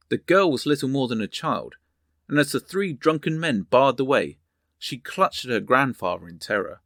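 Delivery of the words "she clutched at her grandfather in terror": ordinary delivery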